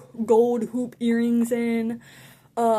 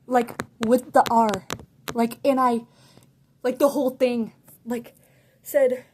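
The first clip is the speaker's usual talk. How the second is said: ordinary delivery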